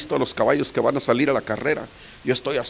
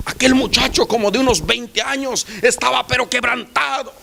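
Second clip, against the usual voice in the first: high, loud preaching voice; fervent sermon climax; full-force preaching; 9 semitones higher, 4 dB louder than usual. Acted. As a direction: proclaim it to the congregation.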